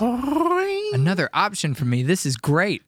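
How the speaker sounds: high, slow, hammed-up ad actor; hammy ad actor. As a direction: gush like a bad commercial actor. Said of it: "you need to be, like, the actor".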